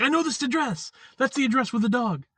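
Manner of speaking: light up as you remember the place; excited recognition. Retold or imitated. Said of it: "I was super excited 'cause I'm like".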